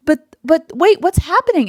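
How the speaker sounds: high, loud, startled cry of confusion; suddenly lost and alarmed, voice jumping up; bewildered panic. Retold or imitated. New mom reliving her shock after the birth, high and startled. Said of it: "I'm like".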